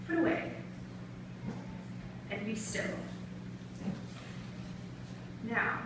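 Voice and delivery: hushed, sparse slam delivery; soft performed poetry with long pauses; spoken-word poem. Acted. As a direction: let each command drop softly into silence.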